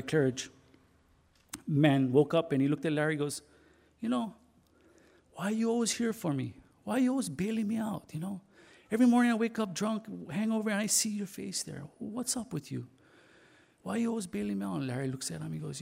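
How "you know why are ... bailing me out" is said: lightly voiced puzzled man, higher pitch; retold plea to know why; puzzled questioning. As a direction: ask in a higher voice, a touch bewildered. Drunk man questions his rescuer.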